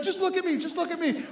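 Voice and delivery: high-pitched intense plea; retold dad desperately coaxing; urgent coaxing. Dad urgently coaxes his kid.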